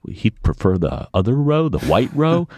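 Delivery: high, knowing question; waitress slyly offering the other roe. Retold or imitated. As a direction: slip the local a wink in her voice. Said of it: "She looks at me and says".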